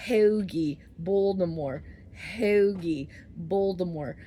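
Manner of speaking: punchy, loud Baltimore regional accent; put-on Baltimore accent. Shown as a demonstration. Showing viewers accents she can do, Baltimore accent. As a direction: show off a Philly-Baltimore accent word by word. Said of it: "I'm not from either of those places".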